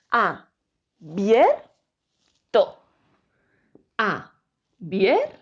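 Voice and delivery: "Abierto" drawn out very slowly, syllable by syllable; slow syllable split. Shown as a demonstration. Teacher slowly showing the class how abierto splits. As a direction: stretch "Abierto" out slowly, keeping "bier" together. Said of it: "Si quiero separar esta palabra en sílabas".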